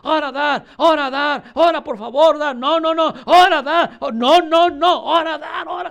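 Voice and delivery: imitating kids pleading insistently; high, repeated whining plea like a child; mock nagging child; begging children. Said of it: "Como tus hijos cuando te dicen".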